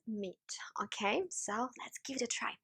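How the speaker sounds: soft whispered explanation; whispering. Real, not acted. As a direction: whisper it close to the mic. Whispered explanation while preparing food.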